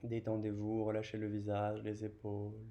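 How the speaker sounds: low, relaxed guidance; soothing yoga relaxation cue; calming. Real, not acted. Easing students into relaxation.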